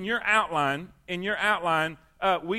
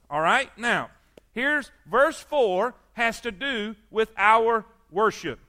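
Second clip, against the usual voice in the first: usual speaking voice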